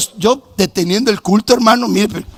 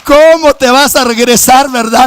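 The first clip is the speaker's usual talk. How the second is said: rising preacher cry; 8 semitones higher, 8 dB louder than usual. Real, not acted.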